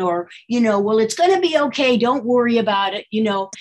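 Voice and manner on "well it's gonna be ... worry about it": mock-reassuring, pitched up; glib sympathizer. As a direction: imitate someone brushing off worries with easy comfort. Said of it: "the sympathetic person was not a very good listener".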